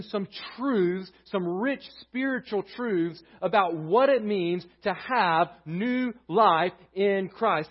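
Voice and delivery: loud, driving preacher's voice; full-force sermon delivery; preaching. Acted. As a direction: preach it out to the congregation.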